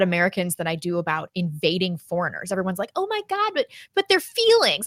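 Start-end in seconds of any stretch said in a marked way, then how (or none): 2.9-4.9 mocking outrage.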